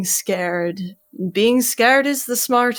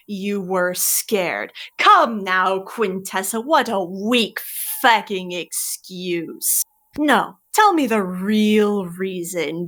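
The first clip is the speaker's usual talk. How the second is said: Curling, scornful mentor. Acted. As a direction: drag out the contempt, mocking every word. GM voices Curling mocking her excuses.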